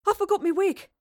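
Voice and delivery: high, loud panicked exclamation; boy racing back for his wig; flustered cry. A narrator's reading. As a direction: dash in shouting.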